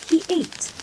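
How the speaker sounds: ordinary delivery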